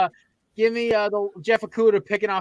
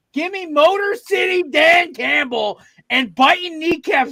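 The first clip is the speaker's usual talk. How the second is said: hyped shout; 8 semitones higher, 4 dB louder, slower than usual. Real, not acted. Hyped sports pick rant.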